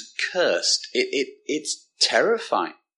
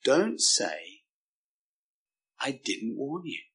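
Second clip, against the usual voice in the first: hushed, spaced-out warning, voicing God; grave, quiet warning without anger; compassionate warning; 7 dB quieter, slower than usual. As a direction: drop right down on "I didn't warn you". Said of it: "not because he's mean or nasty".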